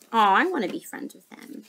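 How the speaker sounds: ordinary delivery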